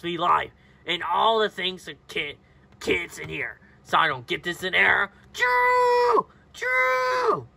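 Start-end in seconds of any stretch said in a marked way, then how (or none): 5.3-7.5 two long, very high calls; high squeal. Pretend. Child making noises in play, high drawn-out squeal.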